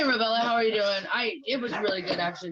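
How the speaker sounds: usual speaking voice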